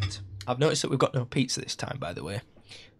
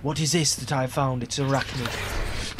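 normal talking voice

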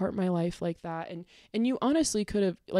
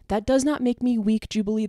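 angry rebuttal; 3 semitones higher, 4 dB louder than usual. Real, not acted. To Jubilee, answering weak: raised angry rebuttal. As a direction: throw it back at them.

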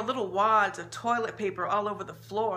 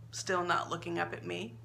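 ordinary delivery, nothing marked

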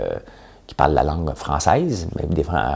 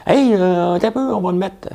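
high mock voice of someone deciding the months; playing the guy who set the calendar; mock inventor; 13 semitones higher than usual. Talk-show host mocking whoever invented the months, high voice.

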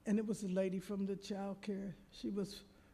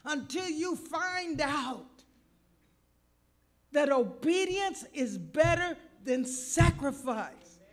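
preaching; 6 semitones higher, 10 dB louder than usual. Acted. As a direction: stretch it out like a preacher at full force. Preaching at full force.

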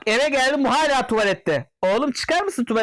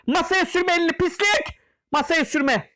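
shrill mock reprimand, higher; high scolding yell; 7 semitones higher, slower than usual. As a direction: scold him in a much higher voice. Scolding a customer. (ince sesle azarlama)

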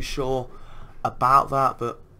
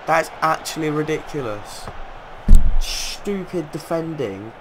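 berserk rage; 3 semitones higher, 5 dB louder than usual. Real, not acted. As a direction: rage at the defending. Raging at bad defending.

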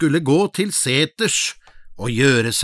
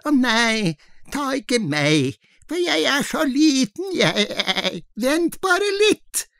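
thin little goat voice; 12 semitones higher than usual. Acted. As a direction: squeak it out like a tiny goat. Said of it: "Den var så tynn og fin i målet".

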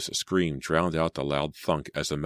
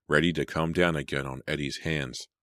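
plain reading voice

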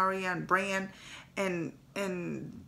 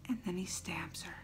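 dropped to a whisper, much softer; hushed whisper; 3 semitones lower, 10 dB quieter than usual. Real, not acted. Fan whispering the twist of the stabbing. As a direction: breathe it like a shock secret.

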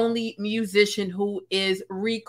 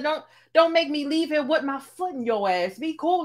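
mock aggressive rapper; 5 semitones higher than usual. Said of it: "he's saying it so aggressively".

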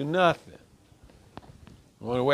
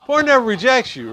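emphatic; 9 semitones higher, 7 dB louder than usual. Real, not acted. Group talk, suddenly louder and higher.

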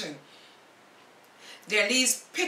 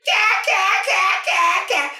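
high, loud, cackling gull voice that jeers; mocking gulls; 8 semitones higher, 12 dB louder than usual. Gulls jeer at Paddy from the sky, squawking high. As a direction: caw and taunt like a cheeky seabird. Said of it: "laughing all the way".

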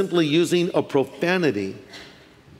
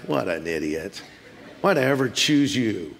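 low, soft exasperated grumble; acted contempt, under the breath; eye-rolling disgust; 8 semitones lower, 4 dB quieter than usual. Imagining Jesus rolling his eyes at Peter, quiet disgust. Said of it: "look of anger, like rolling his eyes".

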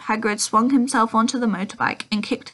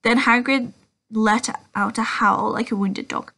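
plain reading voice

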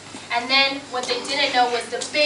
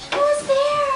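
high-pitched little piggy call; 12 semitones higher than usual. Acted. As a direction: squeak it as a little pig, much higher. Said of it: "the little pig goes".

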